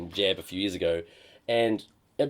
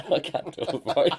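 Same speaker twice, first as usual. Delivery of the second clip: laughing; 8 semitones higher, 5 dB louder than usual. Real, not acted. Cracking up mid-impression.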